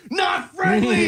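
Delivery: high, long-drawn holler; mock warning yell. Dog owner warning people off with a drawn-out mock yell. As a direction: stretch it into a high imitation shout. Retold video prankster yelling at people.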